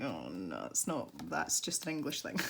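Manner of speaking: low, quiet groaned objection; weary mock correction. Scots answering fans of English things, weary mock correction. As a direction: drop low into a resigned, muttered correction. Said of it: "and we're like".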